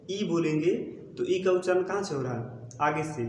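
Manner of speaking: ordinary delivery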